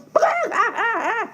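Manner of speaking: high, cackling hen laugh; mocking laugh. A narrator's reading. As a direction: cackle at him. Hen mocks duckling.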